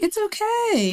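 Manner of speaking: high, gentle reassurance; reassuring. Retold or imitated. Husband reassuring wife after diagnosis.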